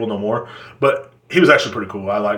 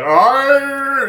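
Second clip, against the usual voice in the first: high, drawn-out mock yell; acted stage-act holler; strained yell; 12 semitones higher than usual. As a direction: hold one high, straining cry. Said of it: "some guys out there like".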